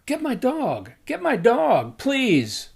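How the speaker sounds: high, loud cry for help; retold shout. Woman calling a stranger to catch her runaway dog, loud high shout. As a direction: yell it like a woman whose dog just bolted. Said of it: "she shouts out".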